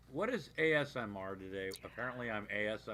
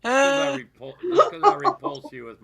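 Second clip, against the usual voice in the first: laughing; 16 dB louder than usual. Real, not acted. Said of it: "my sexy time voice".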